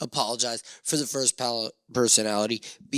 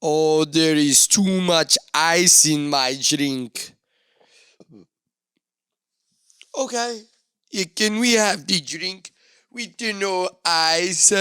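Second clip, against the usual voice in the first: slow, high, louder whiny voice; mock the fussy customer; a bar server imitating a picky guest complaining about ice; 5 semitones higher, 5 dB louder, slower than usual. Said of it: "People at my table asking me".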